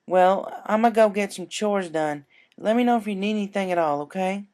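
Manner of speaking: friendly country mare voice; easygoing southern offer; Applejack voice. Acted.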